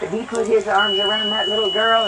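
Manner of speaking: usual speaking voice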